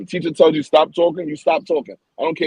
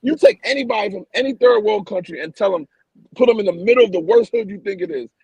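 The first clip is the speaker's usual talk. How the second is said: heated rant; 7 semitones higher than usual. Real, not acted. Heated rant about poverty.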